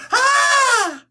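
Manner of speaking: high shrieking scream. Retold or imitated. Burned man screams, [name] joke.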